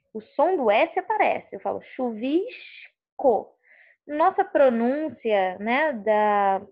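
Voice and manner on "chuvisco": "chuvisco" as chu-VISH-co, S drawn out like sh; hushed Carioca S. Shown as a demonstration. Teacher demonstrating Rio pronunciation, "chuvisco" with a hushing S. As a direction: slowly stretch the sh in "chuvisco". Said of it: "até chiando".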